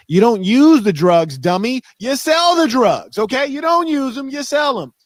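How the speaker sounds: put-on high, loud schooling of a kid; the old heads lecturing a young dealer, loud and high; old head's lesson. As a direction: bark it like the old head setting a boy straight. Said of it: "they taught me".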